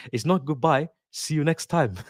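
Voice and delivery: jokey put-on foreign accent; Thiago Silva accent. Imagining the returning player's farewell joke, in a put-on accent. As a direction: badly imitate a footballer's accent. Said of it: "don't know why I'm doing that accent".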